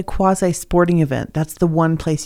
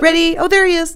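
mock cheerleader; 11 semitones higher than usual. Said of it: "I guess I'll".

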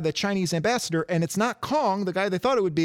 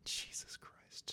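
breathy shocked whisper; slow, hushed under the breath; 23 dB quieter, much slower than usual. Real, not acted. Whispered reaction to nine stars.

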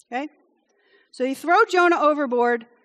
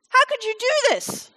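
indignant sailors; 10 semitones higher than usual. Retold or imitated. Sailors confront Jonah.

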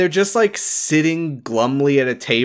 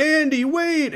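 high-pitched mock call; calling after someone; 14 semitones higher, slower than usual. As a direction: call out as if chasing her. Josh calls after fleeing Andy.

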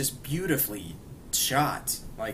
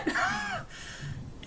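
high-pitched burst of laughter; a squeaky delighted laugh; 5 dB quieter than usual. Real, not acted. Laughing after the twist.

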